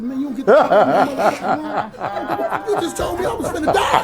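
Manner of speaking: laughing mock yell. Patient tells the doctor off about Michael Jordan, laughing while yelling. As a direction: rant at him half laughing, voice shooting up high.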